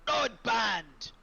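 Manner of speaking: high, loud battle chant; triumphant yell. Acted. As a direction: yell it out like a war cry. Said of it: "I raise it into the air and I yell".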